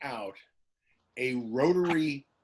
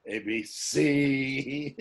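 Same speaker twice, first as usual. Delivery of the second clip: very slow, dragged letter by letter; slow spelled out; much slower than usual. Pretend. Mocking texting on a rotary phone, very slow. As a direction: spell it out at dial speed.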